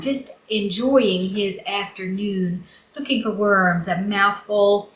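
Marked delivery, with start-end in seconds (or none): none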